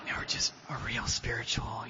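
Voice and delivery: breathy whispered soothing voice; mock spiritual whisper. Mocking spiritual people at meetings, whispered. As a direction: whisper it like a serene guru. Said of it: "that soothing voice".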